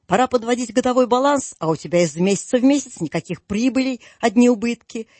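ordinary delivery

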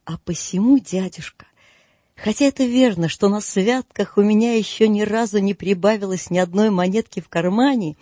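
plain reading voice

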